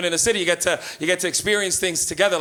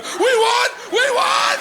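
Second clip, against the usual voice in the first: shrieked way up high and loud; yelling; 4 dB louder, much slower than usual. Retold or imitated. Man leaving washroom pants down, ecstatic shriek. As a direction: scream it from the washroom.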